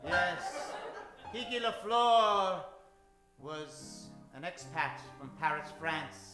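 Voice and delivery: ordinary talk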